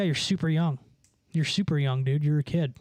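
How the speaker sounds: normal talking voice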